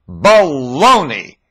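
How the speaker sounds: slow, loud scornful shout; drawn-out angry scoff. Real, not acted. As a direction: spit the word out. Angry dismissal.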